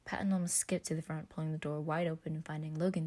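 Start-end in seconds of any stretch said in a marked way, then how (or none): none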